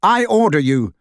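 fiercely commanding the elf; fierce order. A narrator's reading. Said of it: "said Harry fiercely".

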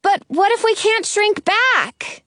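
high, drawn-out worried call; mild yell upward. A narrator's reading. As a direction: call up to someone far above. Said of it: "I yell up to her".

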